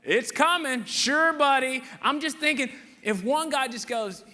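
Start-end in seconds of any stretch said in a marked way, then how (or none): 0.0-1.8 mocking crowd. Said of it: "And they're like".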